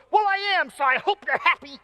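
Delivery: high, loud imitation of a sassy kid; hammed-up tearful comeback; upset child's retort. Daughter sassing dad who kept her safe, upset.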